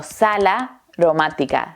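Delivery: SAL AROMÁTICA split as "sala", pause, "romática"; découpage décalé. Shown as a demonstration. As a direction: say "sala", stop briefly, then "romática". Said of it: "Es como si estuviéramos diciendo".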